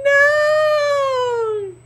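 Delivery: long, high mock-crying "no"; heartbroken wail. Mother retelling losing her child's baby word, heartbroken mock wail. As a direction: drag it out as a hammed-up sob of protest. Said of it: "I was so heartbroken".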